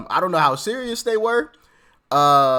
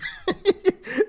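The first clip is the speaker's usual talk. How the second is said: high laugh; 13 semitones higher than usual. Real, not acted. Host laughs at own joke.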